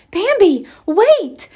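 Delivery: sharp, loud warning call; urgent call. Acted. Mother stops Bambi at the meadow edge, loud urgent call. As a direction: stop him before he runs out. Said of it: "she told him".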